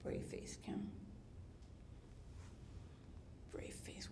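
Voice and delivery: whispered pep talk under the breath; whispered to self. Retold or imitated. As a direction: hush it to yourself, holding back tears. Alone, steadying herself after hurt.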